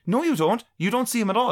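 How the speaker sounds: high, firm contradiction, lightly acted; mock girlfriend retort. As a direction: shut him down as the girlfriend. Girlfriend's retold quarrel.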